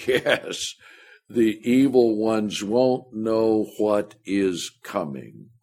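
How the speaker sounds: ordinary delivery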